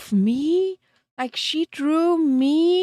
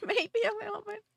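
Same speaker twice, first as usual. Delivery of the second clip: giggled out, higher and fast; laughing; 7 semitones higher, 9 dB quieter, faster than usual. Real, not acted. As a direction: laugh through the repeat, quieter. Retake said through laughter.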